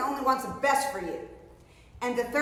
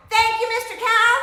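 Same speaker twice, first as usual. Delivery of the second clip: high and loud, cheering it out; loud thank you; 10 semitones higher, 7 dB louder than usual. Shown as a demonstration. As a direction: shout it like leading the kids. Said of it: "I wanna hear you louder than that".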